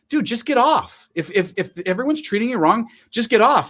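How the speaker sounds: father telling son to log off, high pleading tone; retold advice to son. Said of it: "I go".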